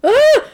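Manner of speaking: high startled yelp, hammed up; mock yelp. Woman hit during a fight, mock yelp. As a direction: yelp like she's been hit. Retold attack, character yelps.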